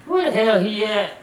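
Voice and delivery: high-pitched irate granny voice; imitated granny, high and testy. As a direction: demand it as a fed-up grandmother. Granny demanding where he is.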